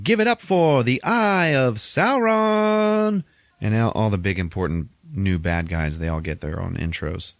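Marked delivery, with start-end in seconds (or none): 0.0-3.2 very high, drawn-out arena introduction; hammed-up announcer. Joker introducing new bad guys to Gotham, as a high drawn-out announcer. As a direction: stretch the name out like a big emcee. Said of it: "introduces everybody, and he's like".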